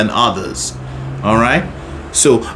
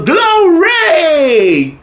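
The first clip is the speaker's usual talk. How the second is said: joyful cry; 12 semitones higher than usual. Shown as a demonstration. Coach shows joy to the student. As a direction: burst out with joy. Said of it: "Oh, I wanna go".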